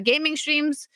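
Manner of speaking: ordinary delivery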